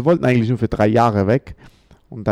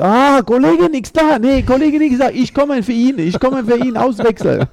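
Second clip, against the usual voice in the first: much higher, louder foreigner-talk, playing along; mock pidgin German; 14 semitones higher, 6 dB louder than usual. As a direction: throw his broken German right back at him. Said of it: "dann ich so".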